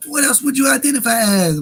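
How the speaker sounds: high, loud mock-baffled question; incredulous. Host heckling Ben Shapiro's identity claim, incredulous. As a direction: fire it off in loud disbelief.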